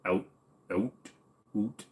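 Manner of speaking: "out" narrowing towards "oot"; northerner "out". Shown as a demonstration. Streamer showing his northern streak, "out" becoming "oot". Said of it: "I'll have a little bit of a northerner".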